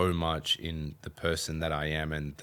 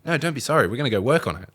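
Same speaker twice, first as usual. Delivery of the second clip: high, louder brisk reassurance; reassuring mum; 7 semitones higher, 7 dB louder, much faster than usual. Retold or imitated. Mum reassuring son before practice: high brisk reassurance. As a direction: brush off the apology, get to work. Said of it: "she's like".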